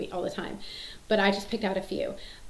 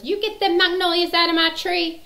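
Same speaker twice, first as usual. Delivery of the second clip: put-on high, loud old-woman yell; cranky holler; 9 semitones higher, 7 dB louder than usual. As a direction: holler it like a crabby movie neighbor. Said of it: "cranky as can be".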